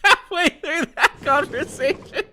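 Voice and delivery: high, loud, squealing with laughter; helpless laughing. Real, not acted. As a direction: barely get the question out for laughing. Helpless laughter at the accent change.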